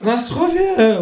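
high, slow toast; calling out a toast. A narrator's reading. Kangaroo toasting the drinkers, high and slow. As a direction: raise the glass and call it. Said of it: "ruft das Känguru".

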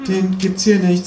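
put-on high-pitched teenage boy voice; high teen counter-clerk voice; sixteen-year-old cashier. A narrator's reading. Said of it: "sagt der sechzehnjährige mit der Kappe".

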